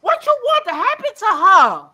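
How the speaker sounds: loud, high, furious demand; indignant shout at the chat; angry shout. Real, not acted. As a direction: yell it at the people in chat. Heated rant at chat.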